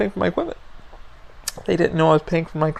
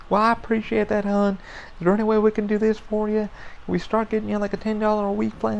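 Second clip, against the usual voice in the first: imitated polite service rep, pitched up; higher voice, sweetly negotiating like a phone agent; call center lady; 5 semitones higher than usual. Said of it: "that's how she talked".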